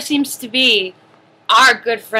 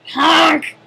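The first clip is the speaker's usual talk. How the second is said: loud clown honk; 5 semitones higher, 5 dB louder than usual. Acted. Clown honks.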